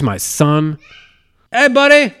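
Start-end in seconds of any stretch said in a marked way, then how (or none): 1.5-2.1 high, loud parental greeting; raised, playful hail. Real, not acted. Calling out to his son.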